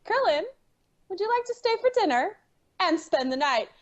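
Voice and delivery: high, theatrical invitation and reply; acted cartoon dialogue. Asking Krillin to dinner and accepting, high and theatrical. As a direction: play it high, slowing on the acceptance. Acting both sides of cartoon scene.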